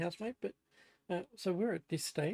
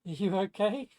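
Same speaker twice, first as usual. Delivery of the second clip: louder check on a housemate; raised voice, asking across the house; calling out; 3 semitones higher, 8 dB louder than usual. Real, not acted. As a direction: call to someone in the next room.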